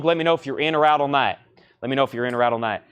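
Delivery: quick, run-together auction patter; hurried, rapid-fire check-in with a bidder; talking fast. Real, not acted. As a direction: rattle it off fast. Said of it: "Sorry, I'm talking fast".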